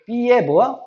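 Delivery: usual speaking voice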